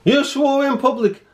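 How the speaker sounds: high mock repetition of the officer's line. Imitating the officer's stubborn repeat, high mocking voice. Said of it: "And he kept on and on".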